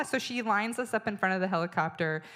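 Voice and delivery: ordinary talk, nothing marked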